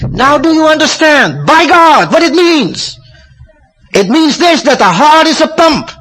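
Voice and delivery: high, loud, thrilled outburst; excited exclamation. Retold or imitated. Harvey crying out his discovery, high and loud excitement. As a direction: burst out like a man who just saw it. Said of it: "he really gets excited".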